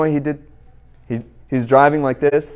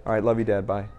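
slipped in casually; 6 semitones lower, 9 dB quieter, faster than usual. Retold or imitated. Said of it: "just slip it in there".

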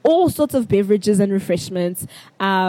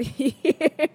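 stifled laugh; 6 semitones higher than usual. Real, not acted. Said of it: "I'm not laughing".

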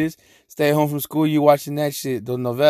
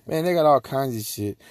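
normal talking voice

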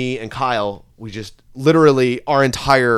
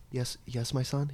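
very soft mock priest reply; quiet, lightly put-on priest; shitty priest voice; 15 dB quieter than usual. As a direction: answer in a hushed priestly murmur. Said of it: "a shitty priest voice".

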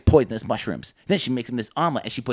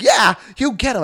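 high, loud egging-on; root for her at the screen; hammed-up spectator shout; mock cheering; 10 semitones higher, 7 dB louder than usual.

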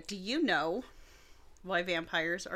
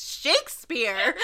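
slow, laughing, rising disbelief; laughing exclamation; 13 semitones higher, 9 dB louder, much slower than usual. Real, not acted. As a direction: repeat it, cracking up. Cracks up at random answer.

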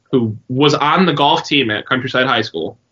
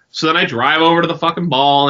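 ordinary delivery

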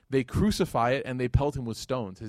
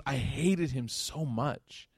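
very slow, softer, dwelling on each word; slow, heavy hatred; 5 dB quieter, much slower than usual. Retold or imitated. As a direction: drag it out with quiet bitterness. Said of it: "He goes".

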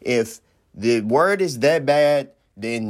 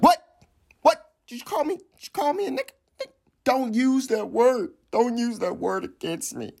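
hammed-up teary whine, much higher; mock crying Kyrie; 11 semitones higher than usual. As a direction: wail it like a fake sob. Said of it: "Man got real emotional".

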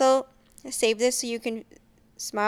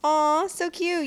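aww; 7 semitones higher, 4 dB louder, much slower than usual. Real, not acted. Reacting to folded paper.

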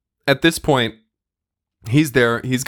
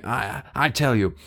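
ordinary talk, nothing marked

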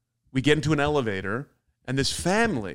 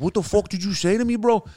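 higher, mock gangster confrontation; sudden gangster; 7 semitones higher than usual. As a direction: challenge him like an offended tough guy. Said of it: "he turns gangster immediately".

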